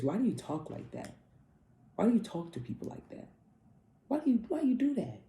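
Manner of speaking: scolding question rising to a high squeak; exasperated scolding. Retold or imitated. Vlogger scolding a Sagittarius friend, exasperated and rising. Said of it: "I always tell Sagittarius".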